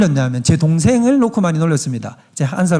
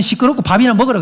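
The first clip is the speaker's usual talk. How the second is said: raised, curt mother quote; brusque mother; 6 semitones higher, 4 dB louder than usual. Retold or imitated. Preacher quoting the mother, curt and raised. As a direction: cut him off, mom style. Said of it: "아니면".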